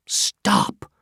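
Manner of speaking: a sharp, breathy whispered stop; urgent whisper. Acted. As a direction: whisper it as a warning, fast and tense. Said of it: "he whispered".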